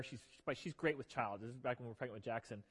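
softly mention the pregnancy; quiet aside. Real, not acted. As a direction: drop into a quick aside. Soft aside about pregnancy.

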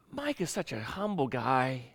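slow, hushed, reverent imitation; mock admiration. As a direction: say it low and soft, as if in awe. Voicing admirers of a humble man.

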